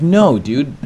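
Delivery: urgent protest. Retold or imitated. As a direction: argue back, mildly panicked. Refusing to remove the strap.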